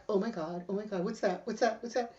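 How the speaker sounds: hushed, repeated little questions; retold nervous muttering. Nervously eyeing the swamp, hushed retold worry. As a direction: replay jitters under the breath. Retells nervous reaction near boat.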